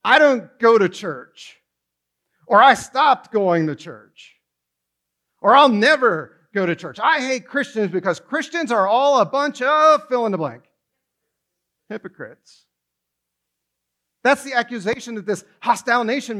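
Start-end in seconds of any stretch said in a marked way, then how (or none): 0.0-10.6 hostile critic. Retold or imitated. Critic of Christians ranting about church, resentful louder complaint. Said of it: "They say". 11.9-12.7 softer, lower, deadpan punchline; quiet, lower verdict. Pretend. Preacher supplying the critics' word, quiet deadpan verdict. Filling the blank, dropped.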